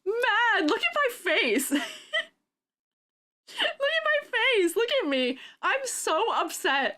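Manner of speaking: high-pitched, worked-up protest; high comic indignation; worked-up outrage. Real, not acted. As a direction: exclaim it high, half laughing at your own upset. Reacts in outrage to her clip.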